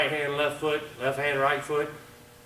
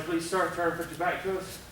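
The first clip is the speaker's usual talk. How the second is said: normal talking voice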